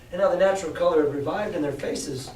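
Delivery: plain reading voice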